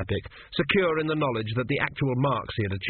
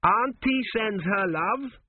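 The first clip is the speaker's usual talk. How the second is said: loudly; 10 semitones higher, slower than usual. A narrator's reading. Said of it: "he said loudly".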